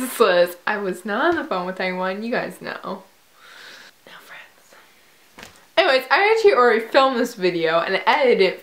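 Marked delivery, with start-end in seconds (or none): 4.1-4.7 very soft whisper; whispered aside. Pretend. Whispered self-mocking aside.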